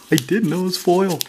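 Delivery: normal talking voice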